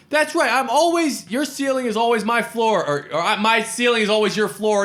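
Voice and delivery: a high, whining squabble voice; high whiny bickering. Retold or imitated. Wesley Snipes bickering with Woody Harrelson in the street, high whining voice. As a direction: whine it like a jealous sibling. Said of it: "And he goes".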